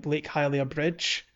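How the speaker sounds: ordinary delivery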